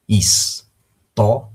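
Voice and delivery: História drawn out slowly, syllable by syllable. Shown as a demonstration. (palavra História dita devagar, sílaba por sílaba)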